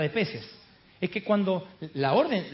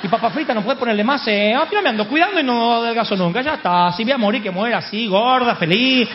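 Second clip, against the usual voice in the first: loud, higher, comically giving up; what's-the-use defiance; 4 semitones higher, 6 dB louder than usual. Acted. Dieter giving up and ordering the extra large, loud defiant surrender. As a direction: throw in the towel with gusto. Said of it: "Esa es la actitud de Pedro".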